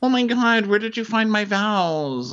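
high, loud mock squeal; hammed-up horrified whine; Amanda. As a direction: wail it way up high, drawing out the end. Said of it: "And Amanda's like".

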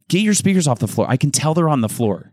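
mock confrontation. Imagining telling the neighbour about the speakers, mock scolding.

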